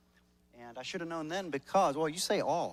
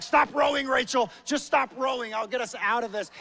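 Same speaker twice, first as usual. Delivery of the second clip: much higher, louder yelled orders; raised, higher shout across the canoe; 9 semitones higher, 10 dB louder than usual. Retold or imitated. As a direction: yell at your partner to stop rowing.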